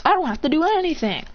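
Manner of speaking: high, whiny-style mimicry of refusal; bratty princess. Boy quoting the princess's refusal, mockingly bratty.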